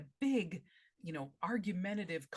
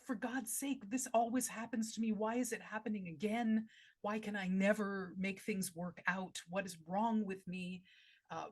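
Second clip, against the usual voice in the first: exasperated, raised pitch, easing as examples pile up; agonized thinking aloud, building a case against oneself; upset self-berating; 3 semitones higher than usual. Acted. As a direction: berate yourself, urgent and frustrated. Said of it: "make it a present moment".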